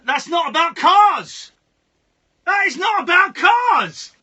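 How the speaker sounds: loud, slow, exasperated shouting. Real, not acted. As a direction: hammer each word in frustration. Exasperated argument over song meaning.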